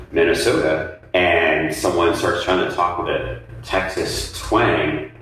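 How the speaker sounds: normal talking voice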